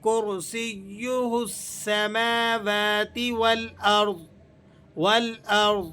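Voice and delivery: slow, drawn-out chanted recitation; measured melodic Quran reading. Shown as a demonstration. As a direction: recite with tajweed, stretching the vowels.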